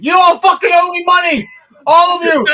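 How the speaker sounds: high, loud triumphant yell; gloating shout. Acted. King yelling at the crowd that they owe him money. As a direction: crow at everyone who bet against you.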